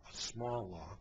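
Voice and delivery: scornful aside. Real, not acted. Host mocking the protester's phrase, quiet scornful echo. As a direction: mutter it back as a sneer.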